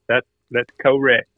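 ordinary talk

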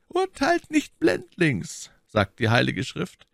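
slow, high-pitched admonition; admonishing. A narrator's reading. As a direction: gently warn against hasty judgment. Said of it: "mahnte der Kardinal".

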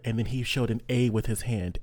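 usual speaking voice

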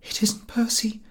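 high, breathless whisper of shock; scared whisper. A narrator's reading. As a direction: say it frightened, barely voiced. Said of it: "scared face".

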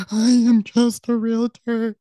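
high, loud, drawn-out tearful wail; mock crying. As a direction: wail it slowly as if sobbing, hammed-up. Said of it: "he sees that I'm crying".

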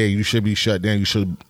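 usual speaking voice

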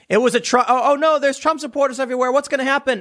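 high, fast hammed-up panic; mock panic. Mocking the actress stuck in a Trump caravan, hammed-up panic. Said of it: "she's crying about it on camera".

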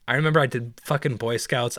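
ordinary talk

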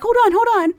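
excited hold on, high and loud; eager hold on. Retold or imitated. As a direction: rush to stop her leaving. Eager to fetch soap.

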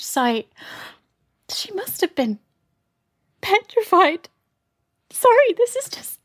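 emotional, welling up. Acted. Overcome with emotion about ancestor.

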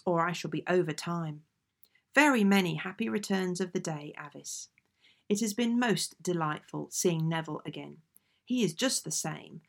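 usual speaking voice

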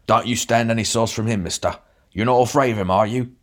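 lightly voiced bruiser egging on; a big beefy man stirring trouble; gruff goading. A narrator's reading. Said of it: "added with a glance at me".

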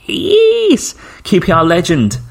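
very high, drawn-out yes; imitated excited confirmation. Football-fan friend confirming the legend, high excited retelling. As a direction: mimic a fan's high-pitched excitement.

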